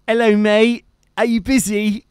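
put-on a mate phoning in a Devon accent; Phil's Devonshire accent. Imitating Phil ringing him, Devon accent. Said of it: "in that typical Devonshire".

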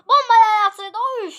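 high, loud burst of alarm; alarmed yell. Real, not acted. Player sees a bomb thrown, alarmed yell. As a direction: yell out the sudden danger.

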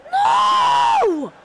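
very high, loud cry of despair; a gamer's outraged scream as the chance is lost; anguished shout. Real, not acted. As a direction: yell no at the screen in disbelief. Reacting to conceded chance.

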